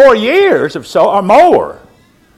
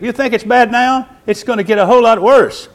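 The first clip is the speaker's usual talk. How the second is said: ordinary delivery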